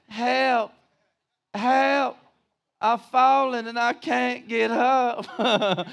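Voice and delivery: a hint of a frail old lady calling for help; hammed-up cry for help with a laugh; mock old lady alarm call. Preacher mocking the medical alert ad, laughing. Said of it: "what does it say".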